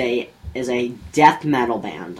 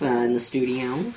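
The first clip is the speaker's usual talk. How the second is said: usual speaking voice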